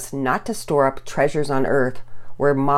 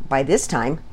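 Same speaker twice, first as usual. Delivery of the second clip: ordinary delivery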